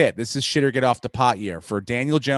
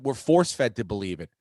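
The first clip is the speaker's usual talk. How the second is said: ordinary delivery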